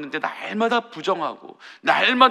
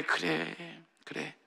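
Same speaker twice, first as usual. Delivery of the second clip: gentle consolation; 14 dB quieter, much slower than usual. Retold or imitated. The Lord consoling.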